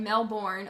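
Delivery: "Melbourne" with a full "born" and sounded R; Melbourne as spelt. Shown as a demonstration. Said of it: "that's not how you say it".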